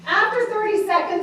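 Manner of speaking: ordinary delivery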